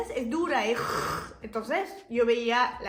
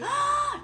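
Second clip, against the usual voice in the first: excited gasp; 14 semitones higher, 3 dB louder than usual. Real, not acted. Excited outburst about art class.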